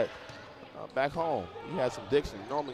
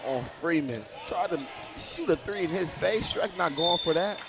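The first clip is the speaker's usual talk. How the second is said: higher and louder praise; still hyped; 7 semitones higher, 6 dB louder than usual. Real, not acted. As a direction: ride the energy of the block. Hyped after the block.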